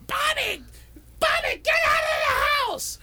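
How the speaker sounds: high frantic shout of a name; yelling. Retold or imitated. Neighbours yelling at Bobby, frantic high shout. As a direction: scream for Bobby to get out. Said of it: "everybody's yelling".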